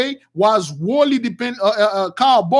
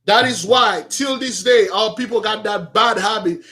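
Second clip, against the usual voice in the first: ordinary talk, nothing marked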